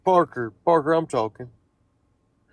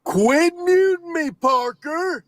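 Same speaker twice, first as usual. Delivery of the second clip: angry yell; 11 semitones higher, slower than usual. Real, not acted. Chatter furious about being muted.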